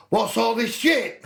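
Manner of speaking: high, slow indignant yell; imitated outburst. Dollar's first words at half time, high imitated yell. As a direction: holler it as a retold complaint, near laughter.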